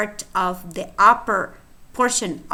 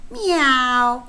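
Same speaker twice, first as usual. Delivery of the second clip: kitten mewing; 5 semitones higher, much slower than usual. Acted.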